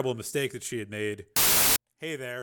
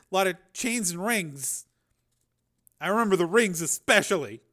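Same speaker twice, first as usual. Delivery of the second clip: put-on higher, hesitant boy's voice describing an attacker; battered boy; 6 semitones higher, 5 dB louder than usual. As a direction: play the hurt kid recalling details. Narrator voices injured boy Jason.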